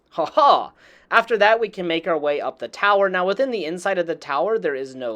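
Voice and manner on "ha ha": drawn-out, gloating fake "ha ha"; playfully smug mock laugh; mock triumphant laugh. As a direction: crow over a beaten foe in mock laughter. Gloats over enemy's fallen body.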